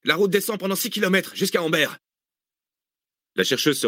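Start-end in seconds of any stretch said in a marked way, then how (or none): 0.0-1.9 high, urgent raised voice; urgent calling out. A narrator's reading. Answering Cécile during the getaway, urgent calling out. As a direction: call it out urgently over the escape. Shouted exchange during the escape.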